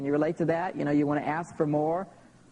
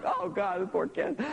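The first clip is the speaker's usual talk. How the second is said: higher, sympathetic sigh of pity; pitying group; 6 semitones higher than usual. Retold or imitated. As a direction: imitate an audience pitying a kid. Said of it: "a whole group going".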